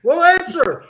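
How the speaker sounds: high, loud comeback; exasperated demand. Real, not acted. Host pressing co-host for an answer, exasperated. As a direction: demand it, pitched right up. Host snaps back in bickering.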